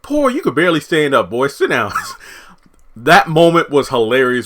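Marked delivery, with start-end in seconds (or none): 0.0-1.9 higher, louder scolding of a stubborn man. Retold or imitated. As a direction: tell him off like a fed-up wife. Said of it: "Sharon looks at him and say".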